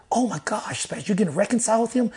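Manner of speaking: high-pitched, astonished question; amazed reaction. Retold or imitated. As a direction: act out friends who can't believe the news. Friends react amazed to his news.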